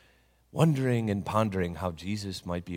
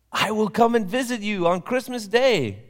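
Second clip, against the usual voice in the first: high, louder voice for Jesus making a promise; Jesus's promise; 12 semitones higher, 7 dB louder than usual. Acted. Jesus promising Papa in a dream, high and proud. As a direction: announce the visit grandly.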